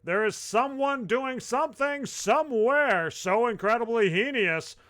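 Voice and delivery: high, emphatic recital; dramatic post reading. Acted. Host reading a listener's alarming post, mock-dramatic delivery. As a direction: read it out like a grave public warning.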